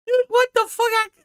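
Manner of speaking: high disbelieving cry; shocked what the; incredulous. Real, not acted. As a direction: streamer reacting in disbelief. Incredulous outburst.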